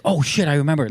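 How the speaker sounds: acted jolt of recognition; sudden recollection. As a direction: blurt it as it suddenly comes back. Imagines own sudden remembering.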